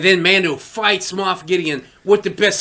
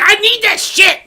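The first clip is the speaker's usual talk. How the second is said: much higher, louder put-on voice; greedy Bo-Katan; 14 semitones higher, 6 dB louder than usual. Mocking Bo-Katan grabbing for the Darksaber. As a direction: squeal it like she's grabbing a prize. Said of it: "She's like".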